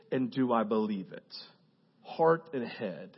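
low, soft and earnest; sincere searching. Real, not acted. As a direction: ask it quietly, from the heart. Quiet searching prayer.

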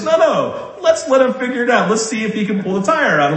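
light mock dad urging the boy on; dad egging him on.

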